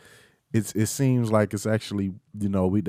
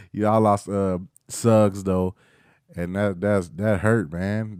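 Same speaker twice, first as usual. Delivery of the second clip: normal talking voice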